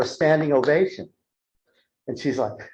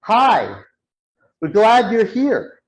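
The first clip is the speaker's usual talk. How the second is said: high, slow, sing-out welcome; smiling greeting; 6 semitones higher, 4 dB louder, slower than usual. Retold or imitated. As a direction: imitate a beaming speaker greeting the group. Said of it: "She's smiling and she's like".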